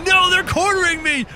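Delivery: high, loud cry of alarm; mock panic. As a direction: shout it as if cornered. Cornered by monsters in game.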